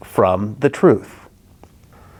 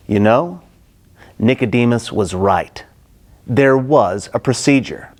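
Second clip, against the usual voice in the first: ordinary delivery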